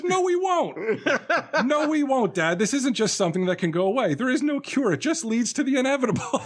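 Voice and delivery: high-pitched, hammed-up protest; mock distraught outburst. Acted daughter's despairing outburst at dad.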